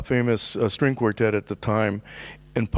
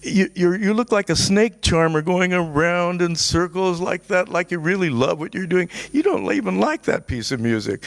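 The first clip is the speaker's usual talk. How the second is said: mocking taunt; 7 semitones higher than usual. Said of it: "and he's laughing".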